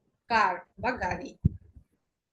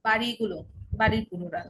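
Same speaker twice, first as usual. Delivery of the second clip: normal talking voice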